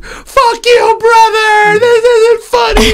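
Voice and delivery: high, loud, tearful yell at the brother; crying angry shout. Retold or imitated. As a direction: scream it like a drugged, furious sister-in-law. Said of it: "I heard her in the other room crying".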